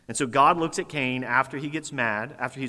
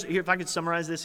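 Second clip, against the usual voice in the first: usual speaking voice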